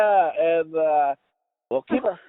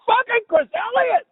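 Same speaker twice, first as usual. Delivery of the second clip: much higher, slow starstruck yell; excited shout; slower than usual. Retold or imitated. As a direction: belt it out like a thrilled fan. Telling boss about celebrity meeting.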